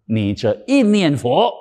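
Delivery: ordinary talk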